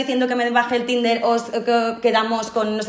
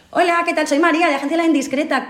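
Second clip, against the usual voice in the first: a higher, cheerful phone voice introducing herself; bright phone greeting; 7 semitones higher than usual. Shown as a demonstration. Agency worker demonstrating how to greet a client on the phone. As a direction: show how a polite caller identifies herself. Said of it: "Yo cuando llamo a algún cliente".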